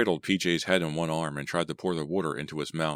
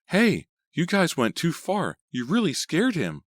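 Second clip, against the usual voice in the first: put-on higher upset girl's voice; 12 semitones higher, 5 dB louder than usual. A narrator's reading. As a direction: scold them in a girl's voice. Sandy scolds the pranksters.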